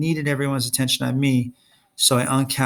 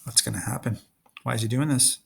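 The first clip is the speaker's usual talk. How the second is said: much softer, worried murmur; hushed aside; 5 dB quieter than usual. Real, not acted. Listener murmuring during the reading, hushed. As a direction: whisper it nervously to yourself. Other speakers whisper aside.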